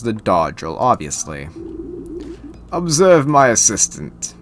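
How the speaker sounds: measured, mostly soft, grandly acted instruction; Sir Manuel. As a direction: deliver the lesson as a ceremonious old master. Plays Sir Manuel giving tutorial.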